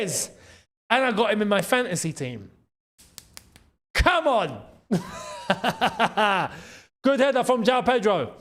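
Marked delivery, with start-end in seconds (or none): none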